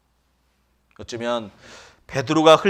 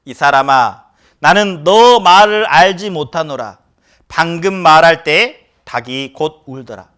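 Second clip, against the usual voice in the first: louder, slow denial as Peter; Peter denying; 2 semitones higher, 8 dB louder, slower than usual. Retold or imitated. Peter denying Jesus, loud slow reading. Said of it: "베드로가 가로되".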